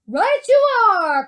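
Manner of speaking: high, loud, drawn-out exclamation; jubilant cry. A narrator's reading. Wonka confirms the girl's guess, loud jubilant cry. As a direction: cry it out slowly and triumphantly. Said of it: "cried Mister Wonka".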